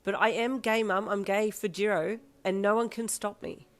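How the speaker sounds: heated protest. Acted. Daughter argues angrily with mum.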